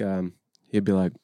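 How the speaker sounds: ordinary talk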